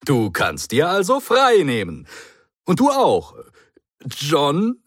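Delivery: a hint of a brisk, chatty Doctor; airy reassurance, talking fast past it; lightly voiced breezy Doctor. A narrator's reading. The Doctor brushes it off to the Brigadier, lightly voiced and breezy.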